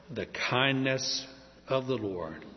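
slowly weighted "kindness"; stress on kindness. Shown as a demonstration. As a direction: lean slowly into "kindness" with the "n" held. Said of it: "the key word in all this is kindness".

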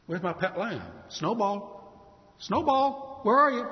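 searching pet owner. Acted. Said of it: "imagine if the man, you know, came home".

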